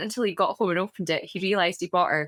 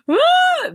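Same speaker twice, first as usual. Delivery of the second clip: put-on high, loud toddler wail; mock child wail; 9 dB louder than usual. Mum imitating her tired daughter crying, high loud wail. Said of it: "she's like".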